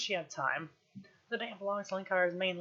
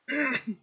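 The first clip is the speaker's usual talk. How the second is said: throat clearing; 6 semitones higher, 7 dB louder than usual. Real, not acted.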